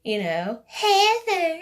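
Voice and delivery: high, loud sugary granny call; sweet old woman voice. Retold or imitated. Grandmother calling her granddaughter, sweet old woman voice. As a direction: coo it like a sweet little old lady. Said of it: "in her little sweet old woman voice".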